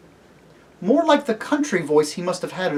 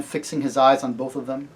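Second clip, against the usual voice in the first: plain reading voice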